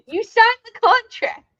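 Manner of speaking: high, loud playful accusation; mock accusation. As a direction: accuse loudly in mock outrage, pitch up. Mock quarrel over the contract.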